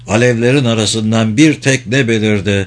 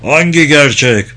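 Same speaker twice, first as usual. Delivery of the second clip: mildly raised, challenging question; defiant shout; 2 semitones higher, 4 dB louder than usual. A narrator's reading. Hutu answers Ervan to show he can take anything, mild defiant shout. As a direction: call it back with restrained force. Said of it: "göğüsleyebileceğini göstermek istercesine bağırıyordu".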